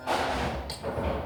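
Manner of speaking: ordinary delivery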